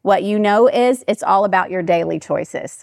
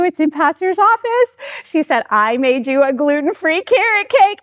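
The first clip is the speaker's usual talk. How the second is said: higher-pitched, eager voice; imitated excited announcement; 6 semitones higher than usual. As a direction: announce your surprise gift, acting it out. Retells baker announcing her gift.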